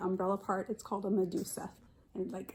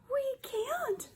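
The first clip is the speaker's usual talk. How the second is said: much higher, small scared fish voice; little red fish. A narrator's reading. Teacher voicing the little red fish, much higher and timid. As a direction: squeak it like a tiny frightened fish. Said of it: "said the little red fish".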